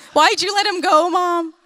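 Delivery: high-pitched imitation of an upset kid; mock child's plaintive protest. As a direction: whine at mom like a distraught son, hammed up. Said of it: "Blake was so upset all week".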